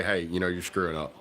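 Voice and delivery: usual speaking voice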